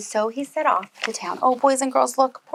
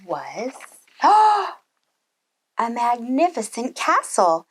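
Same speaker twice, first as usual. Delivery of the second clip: awed; much slower than usual. A narrator's reading. Wonder at castle.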